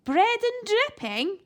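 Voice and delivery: high, slow barked question; high old lady bark; barking granny. A narrator's reading. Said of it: "she barked".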